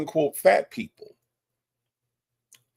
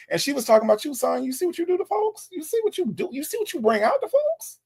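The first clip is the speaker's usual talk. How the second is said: very high-pitched, disbelieving questions; reproachful questioning way up high; incredulous; 12 semitones higher than usual. Real, not acted. Host scolds in squeaky disbelief.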